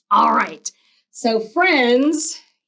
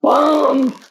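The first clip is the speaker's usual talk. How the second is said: bump sound; 4 semitones higher, 4 dB louder than usual. A narrator's reading. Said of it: "something went bump".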